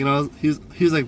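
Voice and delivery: ordinary talk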